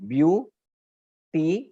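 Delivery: "ब्यू", pause, "टी", each part held out; split into syllables. Shown as a demonstration. Teacher showing a student where the mouth changes, syllable by syllable. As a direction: say "ब्यू टी" as separate, drawn-out beats. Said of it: "इसको तीन part में divide कर सकते हैं".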